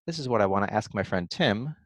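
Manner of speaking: ordinary talk, nothing marked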